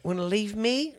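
high, pouty pet voice; whiny dog voice. Pretend. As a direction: pout like a jilted dog. Voicing the dog.

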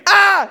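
high, loud short scream; reenacted scream. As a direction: act out your own startled scream. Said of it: "I scream".

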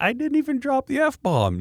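very high, slow squeaky protest; mock protest. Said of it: "I'm like".

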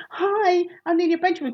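lightly hammed-up host voice; rehearsed presenter greeting. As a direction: practise your cheery online host intro. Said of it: "me going".

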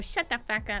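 put-on whiny teenager. Shown as a demonstration. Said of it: "I sound like a whiny teenager".

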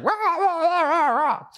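high hammed-up rah rah chant; mock the shouting crowd online, way up high; mock yelling crowd. Pastor mocking angry Twitter users, hammed-up high chant.

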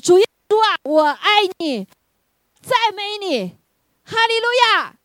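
high, loud, fervent prayer cry; the sister crying out praise over her coworker. Retold or imitated. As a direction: shout praise to Jesus with no care who hears. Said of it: "旁若无人的大声赞美神".

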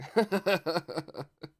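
loud mocking cackle; mean laugh. A narrator's reading. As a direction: jeer with a harsh laugh. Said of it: "burst out laughing".

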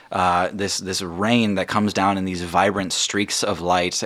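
ordinary delivery

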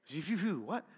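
imitating an indistinct mumbled reply; playful mumble imitation; mock mumbling. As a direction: mumble nonsense, then ask what. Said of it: "you guys, you're mumbly today".

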